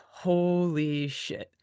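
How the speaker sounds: slow, drawn-out awe; awed. Retold or imitated. Musher awed by the Yukon, slow drawn-out amazement. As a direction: let it sink in slowly, amazed. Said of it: "you're like".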